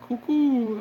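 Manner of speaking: high mock bird call "Cuckoo"; pigeon coo. Imitates pigeon call after pigeons.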